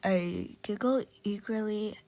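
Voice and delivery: ordinary delivery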